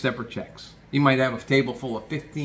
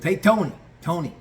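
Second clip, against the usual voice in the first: higher, louder call to his uncle; 7 semitones higher, 5 dB louder than usual. Retold or imitated. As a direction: call across the kitchen. Leo calling his uncle.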